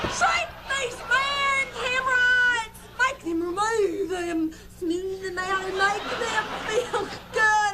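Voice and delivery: shouted, high faith-healer preaching; evangelist healing. Acted. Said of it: "a religious evangelist".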